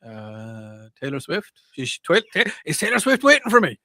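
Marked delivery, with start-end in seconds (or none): 1.8-3.8 excited. Real, not acted. Excited at [name].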